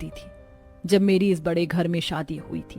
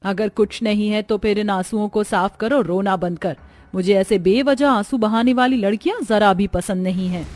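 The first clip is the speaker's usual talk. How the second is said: stern scolding; 11 semitones higher than usual. Acted. Mother-in-law orders bahu to stop crying, sternly. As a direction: tell her off with hard emphasis.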